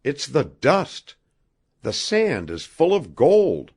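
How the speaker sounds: high-pitched, thrilled revelation; trembling excitement. A narrator's reading. Rod revealing gold dust to Wabi, trembling with excitement. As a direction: announce it high, overcome with excitement. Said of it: "His voice trembled".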